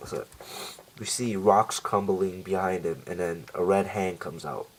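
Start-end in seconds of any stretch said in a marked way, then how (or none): none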